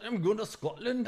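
high, botched Scottish accent; bad Scottish accent. Shown as a demonstration. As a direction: fumble a Scottish accent on purpose. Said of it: "I'm fucking it up".

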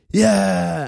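loud, high mock cheer; crowd cheer. Trump supporters answering the slogan, crowd cheer. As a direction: imitate a rally crowd roaring approval. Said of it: "And the Tim Allens are going".

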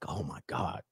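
soft, low mock swoon; hushed awe. Imagined man admiring Swedish girls, hushed mock awe. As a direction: drop to a low, quiet murmur of admiration. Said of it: "You be like".

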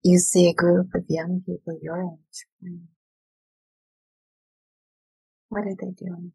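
calm, unhurried visualization voice; guided meditation. Acted. Guided visualization read aloud.

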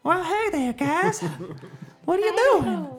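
very high, squeaky Southern character; Gorgamel's voice. Shown as a demonstration. Demonstrating Gorgamel's voice for a player, very high character voice. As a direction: pitch it far up into falsetto. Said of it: "Can you give me Gorgamel's voice".

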